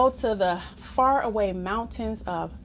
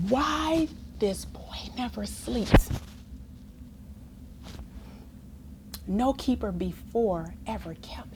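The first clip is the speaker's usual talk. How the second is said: unvoiced awed whisper; 7 dB quieter than usual. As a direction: whisper as if amazed townsfolk gossip. Townsfolk whispering in amazement.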